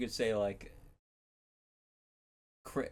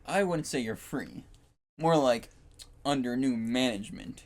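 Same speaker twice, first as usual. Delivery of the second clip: loud, slightly raised movie-villain line; Megamind villain quote; 2 semitones higher, 6 dB louder than usual. Retold or imitated. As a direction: quote it with big theatrical pauses. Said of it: "from Megamind, be like".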